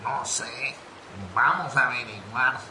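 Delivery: very low-pitched character reply; deep insect voice. Acted. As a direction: answer in a deep creature voice. Second insect answers the first. (voz de bicho muy grave)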